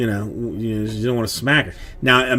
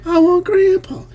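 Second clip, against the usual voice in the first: very high squealed mock tantrum; imitated child screaming. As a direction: imitate a kid screaming for rescue. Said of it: "start screaming".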